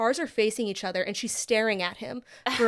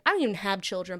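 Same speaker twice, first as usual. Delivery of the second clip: usual speaking voice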